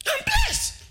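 giddy joyful exclamation; ecstatic squeal. Shown as a demonstration. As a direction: let it fly up like pure delight. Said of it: "will shout".

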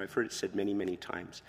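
ordinary talk

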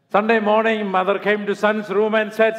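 loud, high storytelling voice; lively joke telling. Acted. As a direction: tell the joke with big energy. Preacher tells joke, loud.

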